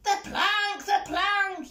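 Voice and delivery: high-pitched yelled chant; pirate yell. A narrator's reading. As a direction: yell it out like an eager pirate crew. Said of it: "yelled Ben Buckle and Percy Patch".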